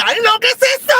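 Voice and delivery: high squeal of alarm at what she finds; panicked shriek. Retold or imitated. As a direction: shriek it in sudden horror. Despertar con un pene, asustada. (chillido de pánico, muy agudo)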